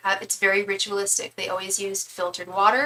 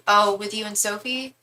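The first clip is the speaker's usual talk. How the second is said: normal talking voice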